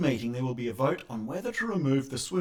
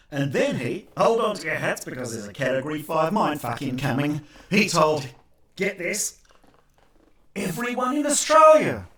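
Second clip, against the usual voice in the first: building louder and higher to the reveal; mock astonishment; 3 semitones higher, 10 dB louder than usual. Real, not acted. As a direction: get louder and louder to a big finish.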